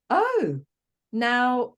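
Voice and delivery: a high, surprised "Oh, now" as the idea lands; sudden discovery. Retold or imitated. Client in session suddenly sees the woman, surprised. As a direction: voice jumps up at the sudden realisation.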